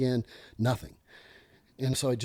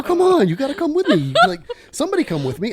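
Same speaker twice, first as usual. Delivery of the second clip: high, loud plea broken by laughter; laughing plea; 14 semitones higher, 11 dB louder than usual. Retold or imitated. Urging scared coworkers to follow him, laughing loudly. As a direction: beg them to come while laughing.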